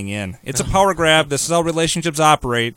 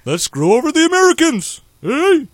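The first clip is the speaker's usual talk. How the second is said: high, loud cartoonish presidential impression; goofy president voice, pitched way up; Barack Hussein Obama; 4 semitones higher, 4 dB louder than usual. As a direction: whoop it out like a cartoon bear politician. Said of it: "Sounds kinda like Yogi Bear".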